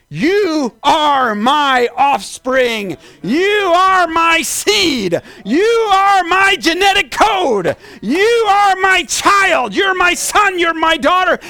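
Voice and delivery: high, loud, drawn-out proclamation; voice of God. Acted. God speaking to a believer, high impassioned proclamation. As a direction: ring out each declaration as God speaking. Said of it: "God speaking to you".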